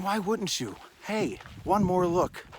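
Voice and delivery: ordinary talk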